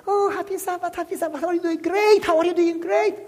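high, put-on singsong greeting. Fake Christians greeting each other at church, high mock-cheery voice.

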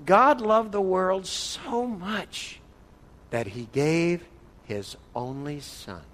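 very slow, weighty read of the verse; slow solemn scripture reading; slow reverent reading. Shown as a demonstration. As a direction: read each word slowly, letting it sink in. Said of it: "So it looks something like this".

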